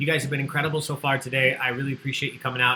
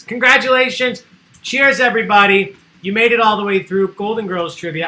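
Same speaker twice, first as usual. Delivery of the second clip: loud, higher, cheering the players; excited congratulations; 7 semitones higher, 10 dB louder than usual. Real, not acted. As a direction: celebrate like a game show host. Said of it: "host congratulates winners".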